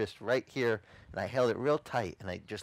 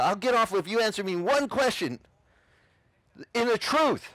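high, raised, insistent retelling; forceful demand; 9 semitones higher, 4 dB louder than usual. Retold or imitated. Convert gripping friend's arm demands answer, forceful demand. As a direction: call it out over a struggle. Shouts demand during the struggle.

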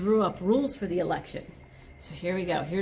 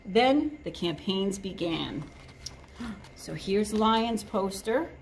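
usual speaking voice

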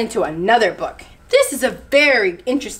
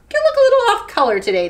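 high, quick animal chatter; teasing remark; 9 semitones higher, much faster than usual. A narrator's reading. Two friends remarking on chameleon's color, high and quick. Monkey and meerkat tease chameleon.

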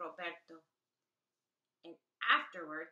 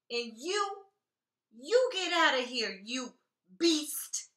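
angry; 5 semitones higher, 10 dB louder, slower than usual. Acted. Roberto consoling toy, banishing monster.

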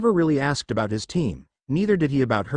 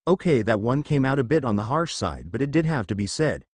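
ordinary delivery, nothing marked

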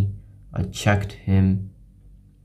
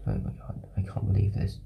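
whisper; 11 dB quieter, faster than usual. Real, not acted. Disbelief at own blunder.

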